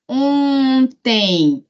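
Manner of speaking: "Ontem" em duas sílabas alongadas; pronúncia demonstrada. Shown as a demonstration. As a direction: estique o O nasal de "Ontem" e separe o tem. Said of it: "eu sempre coloco a palavra assim". ("Ontem" bem devagar, sílaba por sílaba)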